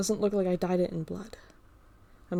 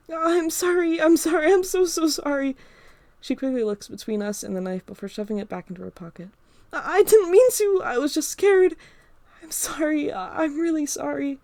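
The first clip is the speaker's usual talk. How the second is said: high, loud, frantic Emma voice; frightened girl apologizing for the knife; panicked apology; 8 semitones higher, 7 dB louder than usual. Acted.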